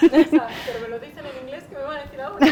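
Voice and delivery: laughing loudly through the words; big laugh. Real, not acted. Mother laughs telling parents about her children, real loud laugh.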